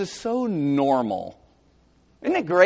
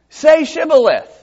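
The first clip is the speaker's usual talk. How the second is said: commanding demand; 10 semitones higher, 8 dB louder than usual. Acted. Preacher voices Gileadite command.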